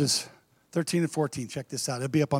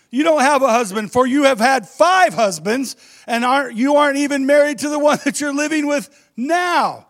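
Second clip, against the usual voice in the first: excited; 8 semitones higher, 5 dB louder than usual. Acted. Jesus confronts woman, laughing.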